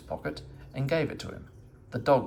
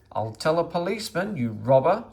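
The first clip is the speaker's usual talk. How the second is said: louder, higher accusing cry; 7 semitones higher, 6 dB louder than usual. A narrator's reading. Woman crying out at Meddle, threatening a policeman. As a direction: call him a robber out loud. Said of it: "cried the woman".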